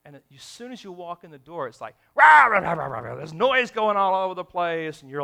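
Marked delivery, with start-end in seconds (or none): 2.2-3.2 imitating a noisy house; mock kid racket. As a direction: bark out the chaos of the kids. Imitating noisy kids at home.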